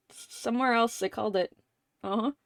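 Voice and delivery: ordinary talk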